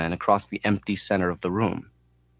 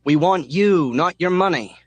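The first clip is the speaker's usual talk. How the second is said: high, loud yell into the wind; 9 semitones higher, 5 dB louder than usual. A narrator's reading. Mechanic yelling at oncoming traffic. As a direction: shout it out the car window. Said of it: "The mechanic yells out the window".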